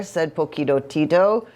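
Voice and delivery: ordinary delivery, nothing marked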